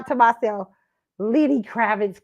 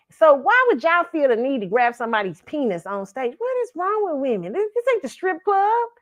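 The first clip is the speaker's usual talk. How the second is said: usual speaking voice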